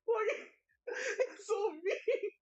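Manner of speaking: high, slow laughing whine; fake-cry it out while laughing; mock wail. Streamer mock-sobbing at chat's teasing.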